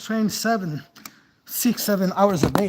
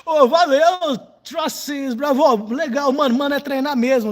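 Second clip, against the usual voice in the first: excited shout-out; 6 semitones higher, 7 dB louder than usual. Real, not acted. Thanking a viewer, calling out.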